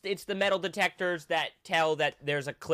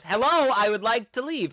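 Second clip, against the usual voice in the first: mock call; 4 semitones higher, 4 dB louder than usual. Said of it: "And she goes".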